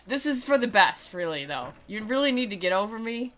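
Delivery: ordinary talk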